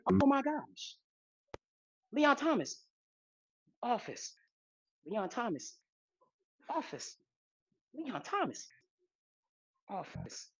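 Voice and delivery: fading to a hushed whisper; excited whisper. Real, not acted. Whispering excitedly at the office door.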